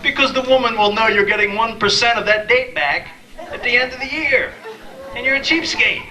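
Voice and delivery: high, put-on mimicking voice for Monica's warning; a mock scold, pitched well up. Friend warning him about cheapskate cards, high mimicking voice.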